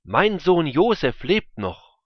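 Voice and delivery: loud, high-pitched exclamation; crying out. A narrator's reading. Father learning that Josef still lives, crying out. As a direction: proclaim it loudly, pitch lifted high. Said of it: "rief er".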